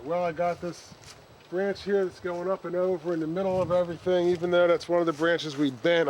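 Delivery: usual speaking voice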